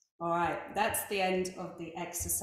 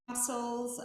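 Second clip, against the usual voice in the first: ordinary delivery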